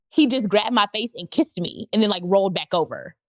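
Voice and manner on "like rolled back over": normal talking voice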